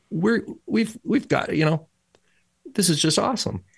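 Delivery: lightly imitated excitement; eager rallying report. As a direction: act out a keen scout searching for words. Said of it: "Joshua and Caleb have one report".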